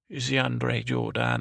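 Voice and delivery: DeAndre Jordan drawn out, French-style "zhor-DAHN"; mock French. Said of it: "I think is what they say".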